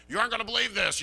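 high-pitched mock gossip announcement; eager gossip. Imagined gossip telling all the campers at the mic, high-pitched mock eagerness. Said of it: "me being the gossip I was".

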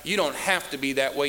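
ordinary talk, nothing marked

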